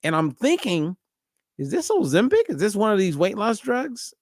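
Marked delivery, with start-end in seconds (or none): 1.6-4.1 much higher, questioning rise; suspicious surprise. Retold or imitated. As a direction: wonder it aloud in alarm. Retelling his suspicion.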